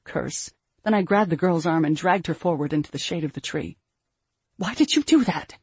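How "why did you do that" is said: high, tense demand, volume held in; held-back scream. A narrator's reading. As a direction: demand an answer, trying not to scream. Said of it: "I tried to keep from screaming at her".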